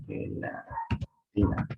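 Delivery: "Gelatina" said very slowly, each sound drawn out. Shown as a demonstration. Teacher slowly modelling "Gelatina" for the class. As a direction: stretch out "Gelatina" with a throaty j. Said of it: "se pronuncia como gelatina".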